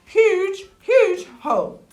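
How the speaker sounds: each "huge" high and loud; emphatic repetition. A narrator's reading. Storyteller showing the hole's size, "huge" loud and high. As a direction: hammer "huge" dramatically, pitched right up.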